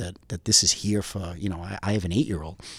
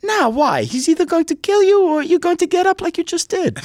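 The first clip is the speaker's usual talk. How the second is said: put-on lightly Greek-accented, higher and louder, brisk; 10 dB louder than usual. Greek buddy recounting the alligator, lightly Greek-accented. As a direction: tell it like an amused Greek buddy. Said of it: "my friend with the heavy Greek accent".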